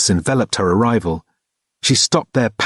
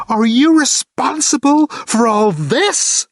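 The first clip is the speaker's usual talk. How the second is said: quiver with rage as you demand it; incoherent rage; 14 semitones higher, slower than usual. A narrator's reading. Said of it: "quivered with incoherent rage".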